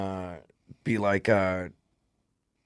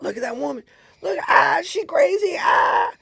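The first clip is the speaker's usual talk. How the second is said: put-on high-pitched excited kid squeal; jeering kids. Kids at the window mocking a screaming woman, high jeering squeals. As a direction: shriek it like mocking schoolkids.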